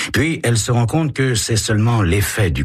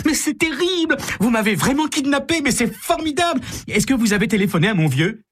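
put-on much higher, thrilled squealing girl; shrieking jackpot winner. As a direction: squeal it like you won the lottery. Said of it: "Carole hurle de joie".

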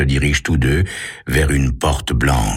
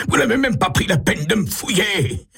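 furious yell. A narrator's reading. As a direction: yell the insult in a high, strained pitch. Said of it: "hurle Pessac".

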